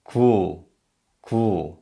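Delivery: "Gu" said clearly, one even syllable; model syllable gu. Shown as a demonstration. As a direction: model "Gu" for learners to repeat. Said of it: "Here's an example".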